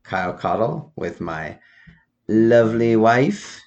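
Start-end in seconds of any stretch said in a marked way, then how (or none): 2.3-3.6 drawn-out host introduction; game show host. Acted. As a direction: present her like a prize. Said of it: "are you purposely doing a, like, game show host?".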